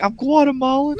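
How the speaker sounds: high, laughing dopey Clark voice; laughing mock Clark. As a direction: squeak it out through laughter, proudly clueless. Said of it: "He's like".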